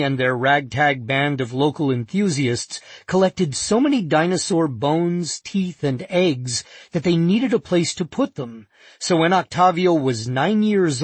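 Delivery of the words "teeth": ordinary delivery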